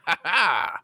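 short mock villain cackle; villain laugh. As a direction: cackle over the henchman. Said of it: "laughing when he dies".

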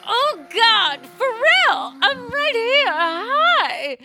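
high, loud, crying fan squeal; fangirl screaming. Retold or imitated. Fan shrieking at [name] over the crowd noise.

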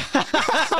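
a real, high laugh at the punchline; high delighted laugh at the joke; high burst of laughter. Real, not acted. Reacting to friend's film story.

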